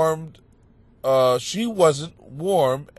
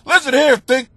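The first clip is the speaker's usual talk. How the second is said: loud, higher-pitched telling-off; mock-angry complainer; 7 semitones higher, 6 dB louder than usual. Angry letter writer addressing the host, mock-indignant. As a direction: scold with hammed-up indignation.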